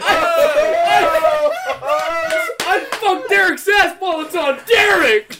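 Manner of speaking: high loud laugh-yell; laughing shout. Real, not acted.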